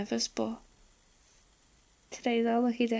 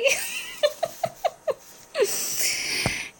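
high bright giggle; 4 dB louder than usual. Real, not acted. Giggles at own joke.

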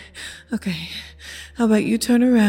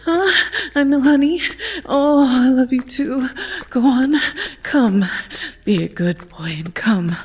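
urgent coaxing; 4 semitones higher, slower than usual. Acted. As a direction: push him over the edge, pleading and eager. Urging partner to climax.

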